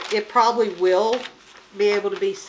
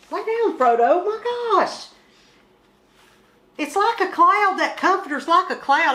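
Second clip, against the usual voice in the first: high, loud pet-talk; 9 semitones higher, 5 dB louder than usual. Real, not acted. Talking to the pet cat.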